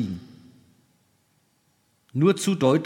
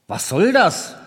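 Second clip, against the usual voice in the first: higher-pitched astonished question; 4 semitones higher, 4 dB louder than usual. A narrator's reading. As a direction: react with raised-voice astonishment. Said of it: "sagte erstaunt". (erstaunt, mit gehobener Stimme)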